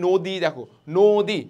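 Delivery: নদী with the first vowel as a closed o, drawn out; changed vowel in নদী. Shown as a demonstration. Teacher showing students the changed vowel, slow o-sound in নদী. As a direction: say নদী slowly as "no-di". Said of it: "ও এর মতো হয়ে যাবে".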